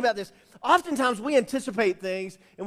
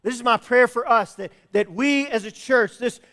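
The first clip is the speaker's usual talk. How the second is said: louder, driving pulpit proclamation; preaching build; 2 semitones higher, 9 dB louder than usual. Acted. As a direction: preach it out to the congregation. Rising preaching close.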